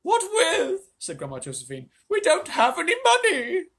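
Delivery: high, loud, slow granny; old woman voice. Acted. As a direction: complain like an old lady.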